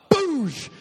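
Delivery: high, loud "boosh"; explosive mock impact of a shot; imitated jab noise. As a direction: burst it out like a sudden jab.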